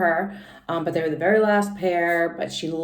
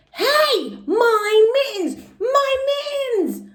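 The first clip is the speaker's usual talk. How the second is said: put-on very high, stretched kid scream; screamed real loud; 5 dB louder, much slower than usual. Junie spotting the pink fluffy girl, high stretched scream. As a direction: yell it like you just spotted your stolen mittens. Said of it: "I screamed real loud".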